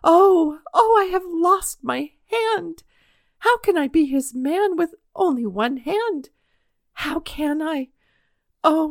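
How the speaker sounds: high, slowed wail of loss, voice straining; boy crying out he has lost his hand; cry of despair. A narrator's reading. Injured boy wailing in despair to the absent doctor, high and loud. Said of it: "a cry of despair rang through the hospital".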